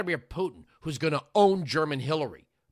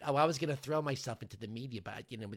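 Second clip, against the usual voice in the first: quick, softer, sing-song mock of her; mocking quote; 2 semitones lower, 8 dB quieter, faster than usual. Host mimicking the guest's remark, mild mockery. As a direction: lightly parody her words.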